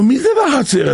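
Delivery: high-pitched demanding question; calling out. Acted. King asks who is in the courtyard, calling out. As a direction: pitch jumps up as if calling across.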